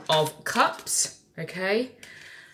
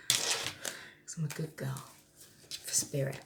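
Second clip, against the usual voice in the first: whispered slowly, mock innocent; playful whisper; 3 semitones lower, 12 dB quieter than usual. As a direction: breathe it like a cheeky confession. Joking aside to Spirit.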